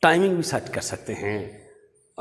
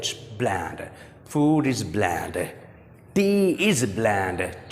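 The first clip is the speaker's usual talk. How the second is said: slow teaching read; 3 semitones lower, much slower than usual. Shown as a demonstration. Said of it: "कह देते हैं".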